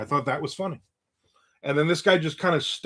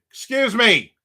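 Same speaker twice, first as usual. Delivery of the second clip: louder, higher repeat to get her attention; insistent calling out; 7 semitones higher, 6 dB louder, slower than usual. Retold or imitated. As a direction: call out harder to someone not looking. Repeats it as she isn't looking.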